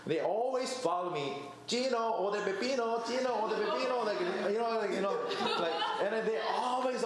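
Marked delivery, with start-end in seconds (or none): none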